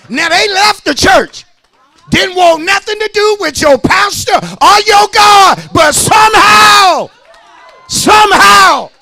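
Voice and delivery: high, loud whooping preach; full-force preaching. Real, not acted. Preacher building to a peak, high loud whoop.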